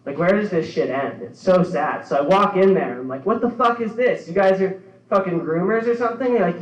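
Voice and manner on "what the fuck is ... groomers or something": a mock outraged yell, pitched up; hammed-up indignant outburst at the dog groomers; angry confrontation. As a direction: storm in demanding answers.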